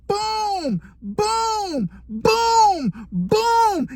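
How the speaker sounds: high, whining booms for each punch; hammed-up punch noises, each one falling; mock punch sounds. Cellmate punching his own nose, high whining boom noises.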